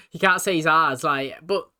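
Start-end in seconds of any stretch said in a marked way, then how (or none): none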